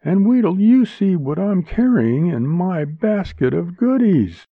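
high, bright woman's voice; bright, high-pitched banter; bright response. A narrator's reading. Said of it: "Linda responded brightly".

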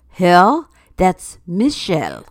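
slow, put-on French accent. Costumed man explains his passenger, French accent. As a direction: answer with a mock French accent. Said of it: "replies in a French accent".

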